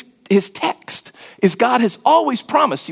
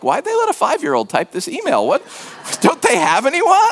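mock disbelief; 7 semitones higher than usual. Readers react to his bad written French.

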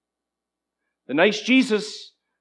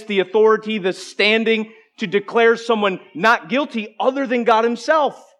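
ordinary talk, nothing marked

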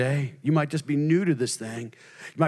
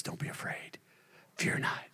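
soft whisper; 12 dB quieter than usual. Acted. God's comfort whispered.

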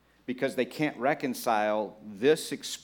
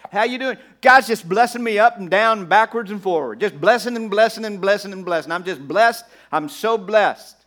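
mock gushing, much higher and much louder; over-exuberant churchgoer; 7 semitones higher, 10 dB louder than usual. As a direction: ham up a believer bursting with blessings. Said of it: "this over-exuberant, everything's wonderful".

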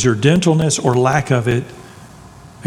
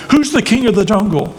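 higher mock bellow; lion bellowing; 6 semitones higher than usual. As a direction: belt it out like a bragging lion. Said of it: "the lion bellowed".

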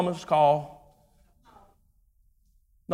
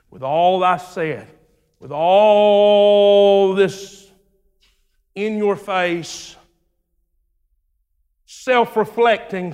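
slow drawn-out emphasis; 5 dB louder, slower than usual. Real, not acted. Drawn-out emphatic sermon build.

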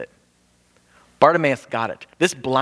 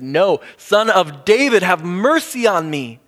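tenacious shout; 5 semitones higher, 5 dB louder, slower than usual. Retold or imitated. Blind man refuses to be silenced.

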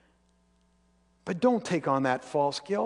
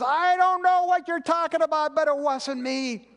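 a mild, imitated angry denial, higher and louder; lashing out; 9 semitones higher, 4 dB louder than usual. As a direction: snap back as Peter denying it. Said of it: "And Peter lashed out".

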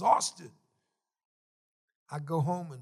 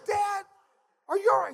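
startled alarm; 8 dB louder than usual. Retold or imitated. Startled son calls to father.

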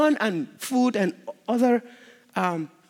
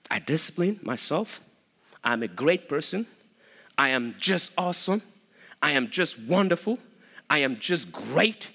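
lower, measured boasting with rising ends; mock self-praise; 6 semitones lower, 3 dB quieter than usual. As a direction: parody a man full of himself, listing his virtues. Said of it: "who talks like that?".

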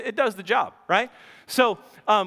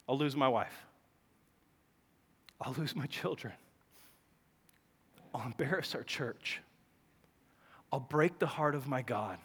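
solemn, hushed; 8 semitones lower, 11 dB quieter than usual. Real, not acted. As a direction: hold back tears. Reading his list, near tears.

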